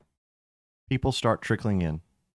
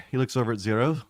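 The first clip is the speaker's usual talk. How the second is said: normal talking voice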